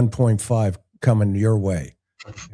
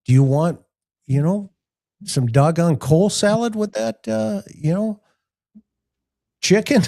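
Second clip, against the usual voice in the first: Colonel voice; 7 semitones higher than usual. Retold or imitated. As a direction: offer it like a Southern chicken pitchman. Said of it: "the colonel".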